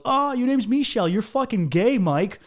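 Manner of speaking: high, loud mock schoolyard taunt; mocking jeer. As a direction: tease him in a loud, high put-on voice. Imitates classmates jeering at his name.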